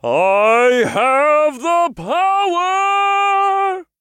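huge drawn-out heroic yell; heroic shout. A narrator's reading. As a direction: shout it high and long, triumphantly. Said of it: "the imp said in a deep voice".

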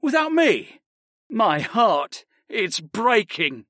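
high, hammed-up wounded wail; mock heartbreak. A narrator's reading. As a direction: overplay the broken heart, slowly. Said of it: "Almasi sniffed mockingly".

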